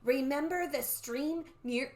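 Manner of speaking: slow, drawn-out eerie reminder; measured, mysterious old-woman voice; old lady voice. Acted. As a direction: intone it like the old soothsayer. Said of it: "oops, that's not the old lady talking".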